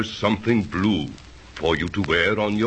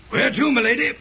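high-pitched coachman; a servant calling from the box; 3 dB louder, faster than usual. Acted.

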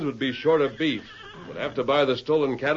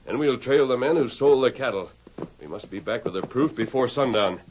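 ordinary talk, nothing marked

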